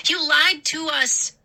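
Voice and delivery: high, loud furious scream. A narrator's reading. Mindy screams at the two gnomes, high and loud. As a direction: scream the accusation. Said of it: "Mindy screamed".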